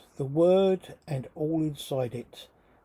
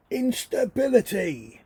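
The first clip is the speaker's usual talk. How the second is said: yell; 8 semitones higher, 6 dB louder than usual. Pretend. Imagined bystanders yelling a word.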